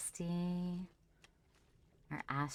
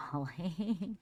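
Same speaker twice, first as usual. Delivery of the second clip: ordinary talk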